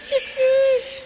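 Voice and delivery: high wheezy grandpa laugh; old man's chuckle. Acted.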